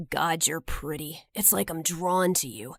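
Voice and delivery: low, soft creepy come-on; creepy flirt.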